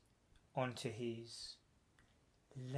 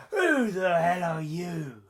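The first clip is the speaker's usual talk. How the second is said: loud, raised-pitch shout at an intruder; angry challenge; 4 semitones higher, 13 dB louder than usual. A narrator's reading. Guy found in the apartment confronting the narrator, angry shout. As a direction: shout it out, indignant and startled.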